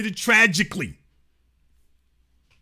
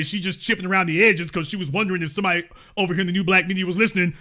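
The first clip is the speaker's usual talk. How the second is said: ordinary talk, nothing marked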